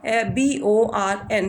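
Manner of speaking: letters of born spelled out slowly; slow spelled demo. Shown as a demonstration. Teacher spelling born for pupils, slow and careful. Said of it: "ओ को हम थोड़ा खींचते हैं".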